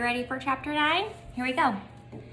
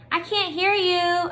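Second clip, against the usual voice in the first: slow, higher shouted call; hollered; 8 semitones higher, slower than usual. A narrator's reading. Opal hollering back at the bald brothers, slow and raised. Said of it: "I hollered back at them".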